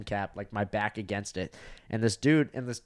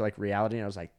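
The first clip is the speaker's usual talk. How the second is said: ordinary delivery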